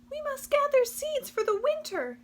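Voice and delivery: very high, squeaky busy mice; tiny mouse voice. Acted. The mice refusing Possum, very high and squeaky.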